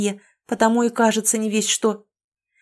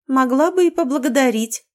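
cheeky; 5 semitones higher, 3 dB louder than usual. A narrator's reading. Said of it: "Голос явно наглел".

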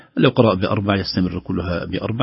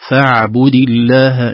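slow, loud chanted recitation; Quran recitation; 3 semitones higher, 8 dB louder, much slower than usual. Shown as a demonstration. Teacher reciting a verse for students in chanted style. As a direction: intone it like tajweed reading.

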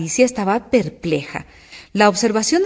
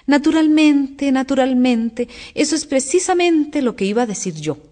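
half-asleep dormouse; 6 semitones higher than usual. A narrator's reading. The woken dormouse claims it agreed, drowsily droning. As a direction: draw it out evenly, barely awake. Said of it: "dijo sin abrir siquiera los ojos".

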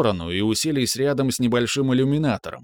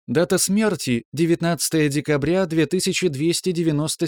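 ship computer voice; 5 semitones higher than usual. Acted. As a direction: report evenly like a ship's AI. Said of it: "механический голос бортового компьютера продолжал".

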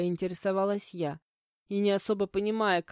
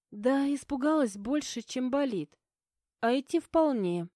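put-on higher-pitched girl's voice, a touch flustered; lightly voiced shaken friend; halting, flustered; 5 semitones higher than usual. A narrator's reading. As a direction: answer haltingly in a raised pitch. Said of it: "сбивчиво начала Оля".

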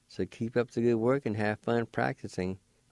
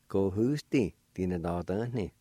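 normal talking voice

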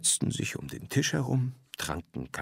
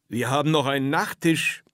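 called out louder and higher; proud host calling out; 6 semitones higher, 6 dB louder than usual. A narrator's reading. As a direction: announce dessert to the whole table. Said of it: "rief Herr Hollerwein".

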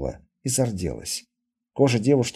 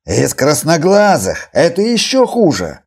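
loud, slow, climbing high in alarm; heated objection; 6 semitones higher, 9 dB louder, slower than usual. Acted. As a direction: throw the objection back forcefully. Healer argues against taking girl. (громко, с возмущённым нажимом)

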